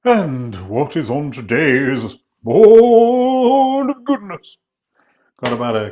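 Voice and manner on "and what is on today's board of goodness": announcer voice. Acted. Announcing the ingredients board.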